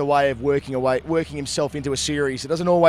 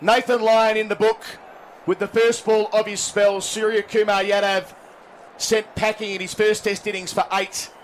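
cricket commentator; 7 semitones higher than usual. Acted. Live TV wicket commentary.

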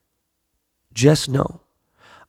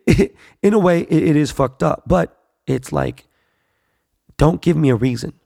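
normal talking voice

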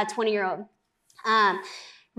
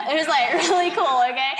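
laughing; 4 semitones higher, 5 dB louder than usual. Real, not acted. Laughs telling the story.

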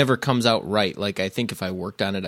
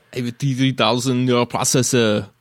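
loud, raised Austrian robot-hero voice; Arnold Schwarzenegger; 4 semitones higher, 6 dB louder than usual. Acted. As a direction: recite it in a heavy Austrian accent. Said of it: "five different Arnold movies now".

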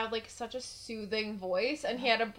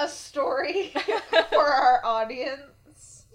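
loud, higher words broken by real laughter; laughing; 4 semitones higher, 7 dB louder, slower than usual. Real, not acted. Introducing the story to the audience, real laughing delivery. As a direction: announce it slowly through the giggles. Cracking up before telling the story.

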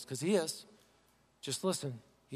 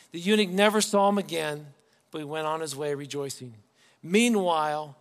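lightly raised reading voice that fades; scripture reading; 5 dB louder than usual. Acted. Pastor reading verse to church, lightly declaimed. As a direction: read the verse out with weight.

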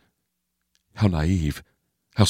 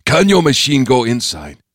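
put-on raised, accented old-man voice; Bo Pan's voice; 6 semitones higher, 4 dB louder than usual. A narrator's reading. Bo Pan pointing at the gash, insistent accented voice. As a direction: press the question, pitched up, with an accent.

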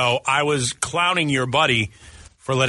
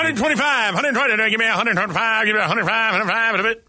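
auctioneer; 5 semitones higher than usual. Pretend. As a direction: call out bids like an auctioneer. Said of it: "I feel like an auctioneer".

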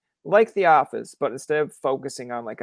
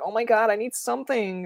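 high-pitched mock-child pleading; whiny little kids; 9 semitones higher than usual. Student mocking Canadians as needy kids, high childish whine. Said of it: "we're like little kids".

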